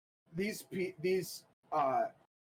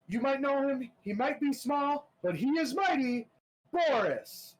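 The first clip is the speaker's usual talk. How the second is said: announcer; 10 semitones higher than usual. Acted. Arena announcer introducing Boris to the crowd. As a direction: hype the crowd for the fighter.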